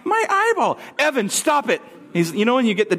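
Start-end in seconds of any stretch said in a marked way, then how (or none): 0.0-0.8 put-on very high toddler squeal; comic little-kid voice; Evan. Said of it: "He's like". 1.0-1.8 high, sharp mock reprimand; exasperated parent imitation; scolding dad. Exasperated dad scolding his son.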